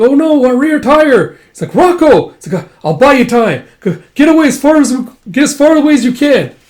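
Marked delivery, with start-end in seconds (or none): 0.0-1.4 high, loud, slow dismay; alarmed cry. Acted. Rocco crying out at the damage. 1.7-2.3 high loud shout of a name; calling out. Acted. Erica calling to Rocco. 2.6-6.5 high, loud stuttering rush; urgent shout. Acted. Rocco staying behind to buy time. Heroic sacrifice.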